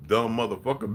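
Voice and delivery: ordinary delivery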